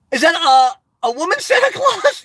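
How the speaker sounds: delighted surprise. Real, not acted. Streamer gasping at a viewer's emoji, delighted surprise.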